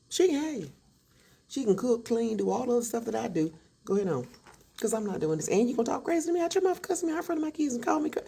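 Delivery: wife tells cheating husband to leave; heated telling-off. Retold or imitated. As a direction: let him have it, fed up.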